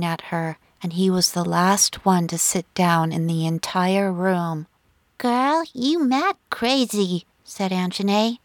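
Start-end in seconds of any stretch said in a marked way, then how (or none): 5.2-7.3 higher-pitched, drawn-out teasing; lightly acted amused disbelief; teasing friend. A narrator's reading. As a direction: slowly needle a crazy friend, lightly voiced. Friend teases Olivia after her stunt.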